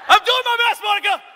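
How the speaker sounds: very high loud whining plea to Monica; husband whining at his wife; whiny husband. Retold or imitated. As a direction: ham up the worn-out husband. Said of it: "acting out our marriage".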